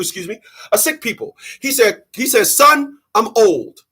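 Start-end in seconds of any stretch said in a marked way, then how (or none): none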